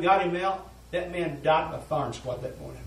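ordinary talk, nothing marked

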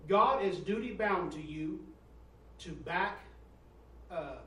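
loud, slow, booming preacher read; preaching at full force. Acted. Preacher booming a quote to his church. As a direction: thunder the quote out to the congregation.

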